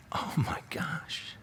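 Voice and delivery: a breathless, slow, voiceless gasp of shock; stunned whisper. Retold or imitated. Listener reacts to the shocking story, stunned whisper. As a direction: whisper it like you can't believe it. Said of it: "You're like".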